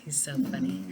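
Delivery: soft unvoiced whisper about the son. Real, not acted. Mother whispering about son putting the dog to bed, whispered. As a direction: breathe it so nobody wakes.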